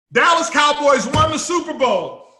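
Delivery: loud celebration of a known result; triumphant shout. Acted. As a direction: shout the win like it's already done.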